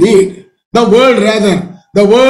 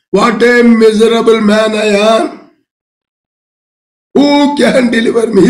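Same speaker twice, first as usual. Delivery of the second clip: anguished cry. Retold or imitated. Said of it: "He says".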